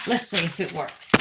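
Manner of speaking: ordinary delivery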